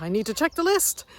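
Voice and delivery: lightly mimicked French bus man; French accent. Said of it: "in his French accent".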